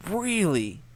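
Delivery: can't believe they asked; answering a crude viewer question, disbelieving; incredulous. Real, not acted. Reacting to the rude question.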